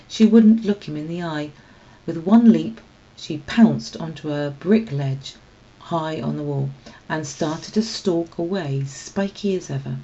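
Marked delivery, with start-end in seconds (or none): none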